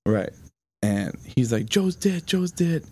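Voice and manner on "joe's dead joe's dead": high-pitched mimicked alarm, repeated urgently; retold alarm. Friend breaking the death news, retold in alarm. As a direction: imitate a frantic friend, pitched well up.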